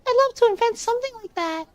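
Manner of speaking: put-on high-pitched, quick nine-year-old; very high little child voice; kid voice. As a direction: pipe up like an eager little kid. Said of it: "I'm reading in this kid voice".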